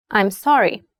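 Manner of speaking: ordinary delivery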